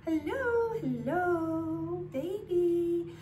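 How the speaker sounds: put-on high, drawn-out hello to a baby; cooing to baby. Mom calling to her baby in yoga, high cooing. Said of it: "pretend that baby's looking at me".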